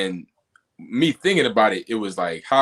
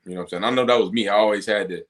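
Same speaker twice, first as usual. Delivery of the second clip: usual speaking voice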